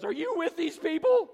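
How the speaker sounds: loud, high-pitched outburst; acted, high and loud accusing question; urgent confrontation. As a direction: demand it in an imitated agitated woman's voice. Retold startled cleaning lady confronts him.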